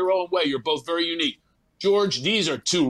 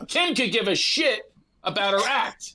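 ordinary delivery

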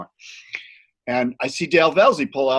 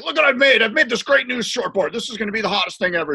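full salesman; 9 semitones higher than usual. Retold or imitated. Dale pitching his new shortboard to Evan, hammed-up salesman. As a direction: sell it hard like a wheeler-dealer. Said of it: "this is the full salesman".

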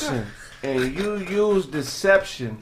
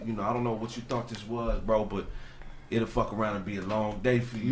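much softer and lower, measured threat; low firm warning; 8 semitones lower, 12 dB quieter than usual. Retold or imitated. Craig telling the photographer on the phone never to call again, low and menacing. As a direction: warn him off quietly and coldly.

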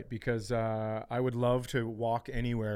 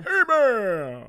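slow, stretched, louder ring announcer call; announcer callout; 7 semitones higher, 9 dB louder, much slower than usual. Acted. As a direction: announce him like a boxer entering. Announcing the guy in corner.

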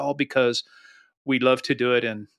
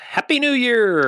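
cheerful sign-off; 9 semitones higher, 4 dB louder, slower than usual. Acted. Sign-off greeting.

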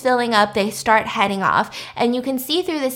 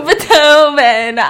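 loud, stretched word through laughter; laughing; 2 semitones higher, 7 dB louder, much slower than usual. Real, not acted. Teller still giggling at the composer joke, laughing. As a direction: draw the name out while cracking up.

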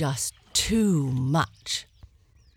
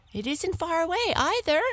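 high, fast hare voice; eager hare; 12 semitones higher, much faster than usual. Acted. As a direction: hurry to tempt the lion. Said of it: "added the hare".

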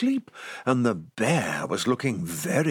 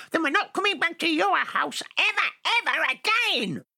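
high squeaky elf voice. Acted. Elf's reply in high voice.

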